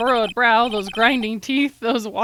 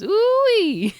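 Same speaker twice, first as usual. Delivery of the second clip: hammed-up whoop; 11 semitones higher, 5 dB louder than usual. Voicing folksy character exclamation.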